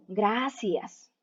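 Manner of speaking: ordinary delivery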